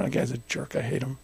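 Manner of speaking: hint of seething said under the breath; inner grudge. A man nursing his anger at a jerk, softly seething. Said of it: "Let's say it's anger for me".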